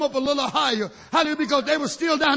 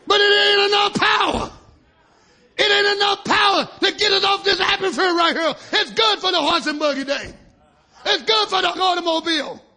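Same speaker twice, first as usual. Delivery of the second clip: full-force preaching; 8 semitones higher, 3 dB louder than usual. Acted. Preacher quoting Brother Brandon to his church, shouting. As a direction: proclaim it with everything you have.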